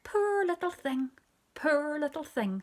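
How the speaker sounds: put-on high, pitying coo over a baby; cooing neighbour lady. A narrator's reading. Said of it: "when Missus Jones said".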